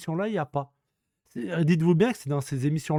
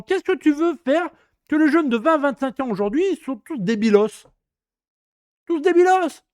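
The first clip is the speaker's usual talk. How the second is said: indignant rant; 6 semitones higher than usual. Real, not acted. Coup de gueule indigné.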